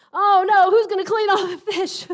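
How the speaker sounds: high, loud, whiny wail of dismay; mock panicked Peter. Joking pastor voicing Peter dreading the fish, high whiny wail. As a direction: wail about the chore ahead, hammed-up. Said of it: "it didn't say that either".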